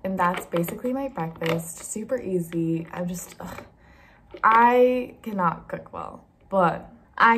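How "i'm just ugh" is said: breathy whispered groan; whispered ugh. Real, not acted. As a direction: sigh it out under your breath. Exasperated aside.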